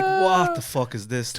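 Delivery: high, drawn-out disbelief; incredulous. Retold or imitated. Recalling watching the baffling act, high-pitched incredulity. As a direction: blurt it way up high, stretched out. His baffled reaction at the show.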